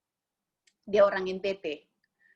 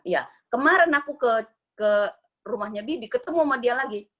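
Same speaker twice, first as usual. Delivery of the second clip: ordinary talk, nothing marked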